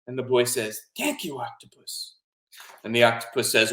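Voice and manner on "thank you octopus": softly voiced boy saying thanks; grateful little boy. Boy thanks his octopus friend, softly grateful.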